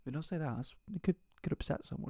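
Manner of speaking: mock the nervous, overcautious crowd, hushed; prissy pearl-clutching. Mocking people afraid to say fat, hushed and prim. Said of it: "people almost be like".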